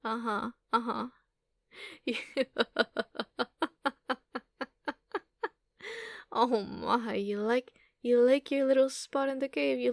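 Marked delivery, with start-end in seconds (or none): 1.8-4.6 amused laugh. Acted. Creature laughing at the human who likes her trinkets.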